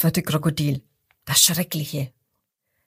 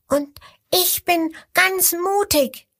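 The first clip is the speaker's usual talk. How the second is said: much higher small voice, slow and proud; the smallest one puffs itself up; brave little crocodile; 10 semitones higher than usual. A narrator's reading. Little crocodile calls to the hyena, tiny but brave. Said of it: "Das fünfte ruft".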